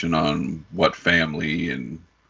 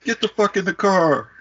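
much higher, loud barking order into the car; tough guy yelling; 4 dB louder than usual. Retold or imitated. Mobster boyfriend ordering his girlfriend into the car, hammed-up yell. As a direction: bark it like a hothead ordering his girl. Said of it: "he cuts to the guy like".

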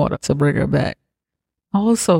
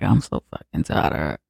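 much lower, weary, fed-up grumble; 11 semitones lower, 4 dB quieter than usual. Retold or imitated. Family members tired of the mom, lower weary grumble. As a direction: drop the voice low and sound worn out. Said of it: "that's how they face looking like".

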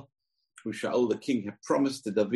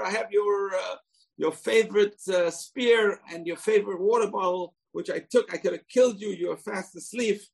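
David pleading; 10 semitones higher than usual. Acted.

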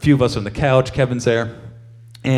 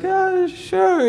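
mock Shane voice. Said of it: "He's like".

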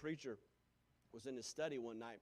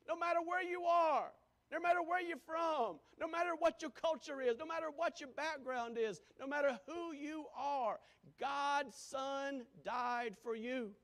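higher and louder, rising sermon cadence; preaching at full force; 12 semitones higher, 9 dB louder than usual. Real, not acted. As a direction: preach it out with mounting fervour.

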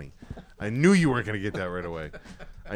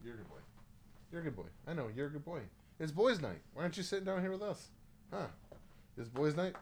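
praising the dog; 10 dB quieter than usual. Real, not acted. Owner praises his dog during boys' night, soft pet-talk.

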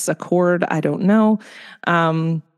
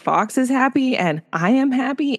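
higher, lightly imitated child's voice; young son; 6 semitones higher than usual. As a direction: play a little boy explaining to mom. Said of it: "And he's like".

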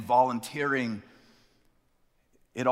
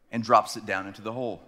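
normal talking voice

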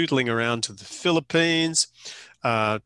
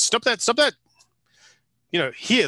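imitated boss scolding; 11 semitones higher than usual. Boss scolding dancers in studio.

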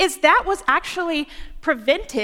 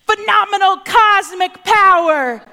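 loud, higher, mock-epic; booming grandeur; 5 semitones higher, 8 dB louder than usual. Preacher mocking the grand expectation, booming. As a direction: announce it like a blockbuster trailer.

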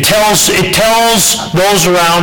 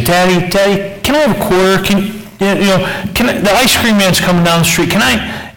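put-on higher, pleading child's voice; lightly voiced little girl; 6 semitones higher than usual. Preacher voicing the little girl begging her dad, higher and pleading. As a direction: beg sweetly like a small daughter.

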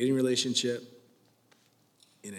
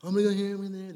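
mocking selfish boy; 6 semitones higher, much faster than usual. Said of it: "Boys do whatever they wanna do".

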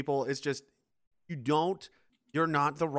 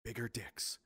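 hushed whisper, much softer; whispered punchline; 5 semitones lower, 13 dB quieter, slower than usual. Pretend. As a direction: drop to a secret whisper for the joke. Punchline of mock speech.

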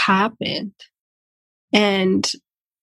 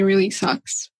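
usual speaking voice

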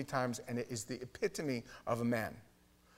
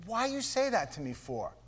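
high defensive; 9 semitones higher than usual. Retold or imitated. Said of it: "He goes".

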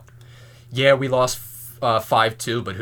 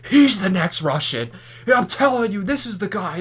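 high, loud hyped-up fan voice; excited fan; 10 semitones higher, 5 dB louder than usual. Retold or imitated. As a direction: hype him up like a true believer. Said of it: "all of Montreal was like".

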